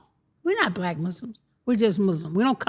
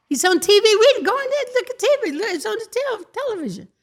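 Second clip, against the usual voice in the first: much higher excited telling; retold excited father, higher pitch; 12 semitones higher, faster than usual. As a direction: point at the TV, amazed.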